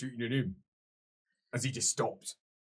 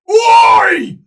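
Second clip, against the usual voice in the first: loud, high ogre shout; ogre bellow; 31 dB louder than usual. Acted. Ogre bellows at the hidden party, loud. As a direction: bellow it across the woods. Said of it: "Comes a big, deep voice".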